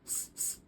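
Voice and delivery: 's' as a soft voiceless hiss; S sound. Shown as a demonstration. Teaching the letter as S for salsa, bare hissed sound. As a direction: hiss S, s as two separate sounds. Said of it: "S for salsa".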